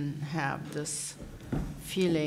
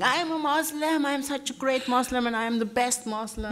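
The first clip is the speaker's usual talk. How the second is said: mock boasting; 7 semitones higher than usual. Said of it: "running around and shouting".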